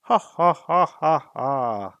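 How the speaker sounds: loud booming dragon laugh, tailing off; dragon laughing aloud. A narrator's reading. Smaug mocks the hobbit's plans, loud laughter. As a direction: let the dragon's laugh ring out. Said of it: "And Smaug laughed aloud".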